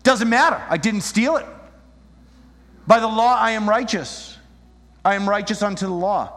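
high, loud mock righteousness; self-righteous insistence. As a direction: declare yourself righteous, hammed-up. Acting the self-righteous legalist.

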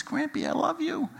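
put-on soft, high little girl; sweetly, as the small granddaughter; high, hushed child's "I love you". Granddaughter's sweet line in story.

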